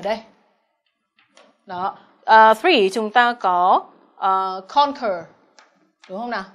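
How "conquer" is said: "conquer" đọc chậm, nhấn âm đầu "con"; kéo "con", hạ nhẹ "quer" trong "conquer"; trọng âm một. Shown as a demonstration. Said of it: "Trọng âm một". (nhấn âm tiết đầu của "conquer")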